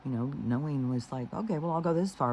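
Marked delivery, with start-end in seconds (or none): 1.3-2.3 higher-pitched, lightly acted agreement; imitated agreeable concession. Setting good boundaries in love, voiced as higher, easy agreement. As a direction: voice someone conceding up to a limit.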